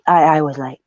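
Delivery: ordinary delivery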